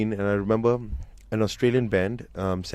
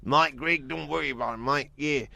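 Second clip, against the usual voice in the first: higher, upbeat Australian mate voice; Aussie reassurance; 6 semitones higher than usual. Retold or imitated. Bandmate calming Greg before the gig in an Australian accent.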